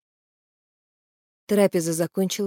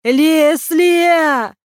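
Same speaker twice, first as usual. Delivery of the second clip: high, loud drawn-out cry, growing higher; shouted call; 11 semitones higher, 9 dB louder, much slower than usual. A narrator's reading. Киран calls out to Леслия, shouting louder. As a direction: cry the name out long, the second even higher. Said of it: "закричал Киран".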